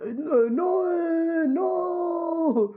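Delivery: dazed, drawn-out high moaning; sonné. Retold or imitated. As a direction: stretch the words like someone knocked silly. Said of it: "j'ai failli parler en turc tellement j'étais sonné". (voix sonnée, traînante et aiguë)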